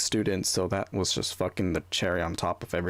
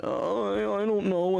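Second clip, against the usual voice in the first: higher, small unsure voice; a hint of timid kid; 9 semitones higher, slower than usual. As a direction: imitate your younger self fumbling for an answer. Kid unsure answering teacher.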